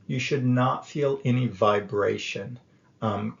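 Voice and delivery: usual speaking voice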